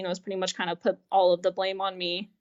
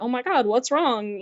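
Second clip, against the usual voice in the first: fake concern; 4 semitones higher, 3 dB louder than usual. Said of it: "kind of fake face of like".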